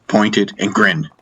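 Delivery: usual speaking voice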